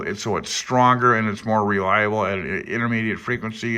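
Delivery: ordinary talk, nothing marked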